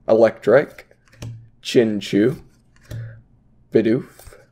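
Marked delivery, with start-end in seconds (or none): none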